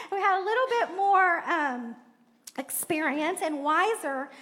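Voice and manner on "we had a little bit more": words squeezed out through a laugh; real laughter breaking in. Real, not acted. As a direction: laugh your way through the line. Laughing at own story.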